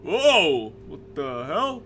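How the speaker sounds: loud, high whoa, then easing; startled outburst. Acted. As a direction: exclaim as you tumble out. [name] falls out of creature.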